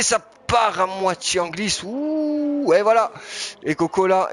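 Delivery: rising excited yelp on a slide. Real, not acted. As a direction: call the near-crash with alarm. Car spins, live race call.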